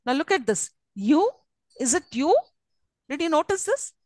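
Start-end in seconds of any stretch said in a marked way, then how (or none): 0.9-2.4 "you" swept upward as a question; rising tone. Shown as a demonstration. Teacher asking students to notice, rising tone on "you". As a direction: raise the final "you" clearly. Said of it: "rise it".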